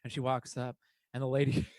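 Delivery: ordinary talk, nothing marked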